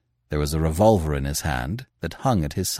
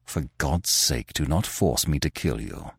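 almost a whisper; 4 semitones lower, 5 dB quieter than usual. A narrator's reading. Gunman pleads with the editor not to interfere, almost whispering. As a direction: sink the voice low and soft, deadly earnest. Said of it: "his voice sank almost to a whisper".

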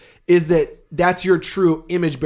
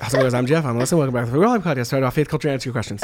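rattled off fast in a lower voice; speed-run intro; 3 semitones lower, much faster than usual. Shown as a demonstration. Host showing co-host how fast he can go, rushed. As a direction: race through it as low, quick patter. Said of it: "Okay, now do it normal".

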